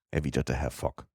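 plain reading voice

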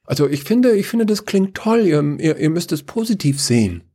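high, stumbling enthusiasm; eager Chaos. Acted. Chaos urging the council to be positive, eager. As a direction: pitch the idea brightly, tripping over words.

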